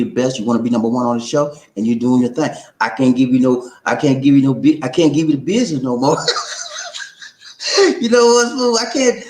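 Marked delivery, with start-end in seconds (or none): 6.2-7.3 very high laugh. Real, not acted.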